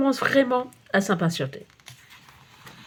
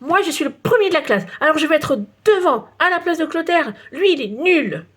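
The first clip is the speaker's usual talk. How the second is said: put-on child's voice pitched higher, smug and boastful; Agnan vantard; 7 semitones higher than usual. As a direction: brag like the top pupil in class. Voix d'Agnan, élève vantard. (petite voix aiguë d'élève prétentieux)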